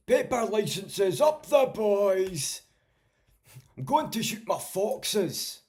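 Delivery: very high, loud, slow Scottish caricature; Davey. Retold or imitated. Impression of a streamer from Perth, high loud drawn-out Scottish voice. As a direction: holler it slowly in high-pitched broad Scots. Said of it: "That's, that's Davey".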